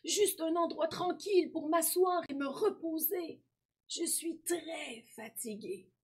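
high voice slowing into tiredness; weary old man. A narrator's reading. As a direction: ask for rest, dragging the last words. Exhausted old boy wants to rest. (vieil homme épuisé, voix haute qui ralentit)

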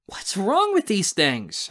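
high, slow, exasperated whine; complaining. A narrator's reading. Narrator fed up with jammed guns, complaining. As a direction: complain loudly and drawn out. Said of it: "I complain".

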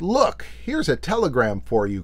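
usual speaking voice

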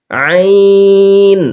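আইন with the sound held very long; long drawn-out ain. Shown as a demonstration. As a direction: stretch আইন out slowly from the throat. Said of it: "একটু আওয়াজটাকে লম্বা করতে হবে". (আইন লম্বা টেনে উচ্চারণ)

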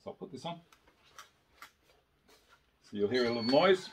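muffled under a helmet; putting helmet on. Real, not acted. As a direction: voice behind a face shield. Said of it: "I'm gonna put the helmet on".

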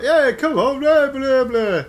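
high, loud mocking mushroom chatter; high, loud dismissive fungus; mushroom grumbling. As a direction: brush them off with a sneer.

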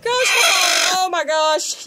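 real high scream; screaming in fright. Real, not acted. Hen bites at the phone, real frightened scream. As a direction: shriek as the hen strikes.

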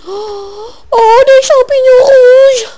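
high, loud, slow cry of delight; excited squeal. Pretend. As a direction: squeal at the red mushrooms. Excited at red mushrooms.

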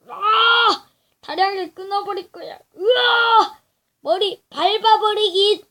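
loud, high fighting shouts; battle yells. Real, not acted. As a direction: shout while attacking hard. Fighting the octopus. (싸우며 외치듯)

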